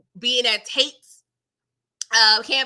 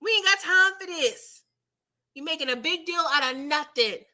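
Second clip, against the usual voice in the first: a hint of a bossy woman scolding; 5 semitones higher than usual. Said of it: "So she said".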